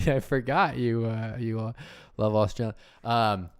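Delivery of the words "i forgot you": ordinary talk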